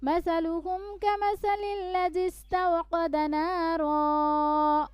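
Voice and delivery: high, slow melodic recitation; Quran recitation. Shown as a demonstration. Teacher recites the passage for students to follow. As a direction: chant slowly and lift the long notes.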